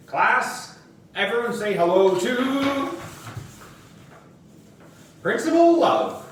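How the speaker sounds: high, loud sing-song introduction; teacher presenting the principal to class; announcing teacher. Acted.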